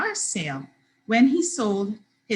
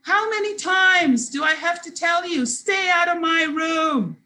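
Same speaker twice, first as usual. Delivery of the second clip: exasperated yell; 8 semitones higher, 3 dB louder than usual. A narrator's reading. Brandon ordering his sister out of his room, yelling. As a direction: yell it high, stretching the last words. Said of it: "He went on to yell".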